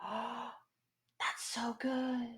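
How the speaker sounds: awed, drawn-out whisper; hushed, high-pitched amazement; freaking out. Real, not acted. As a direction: gasp and whisper it slowly, overcome with delight. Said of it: "I know I'm freaking out right now".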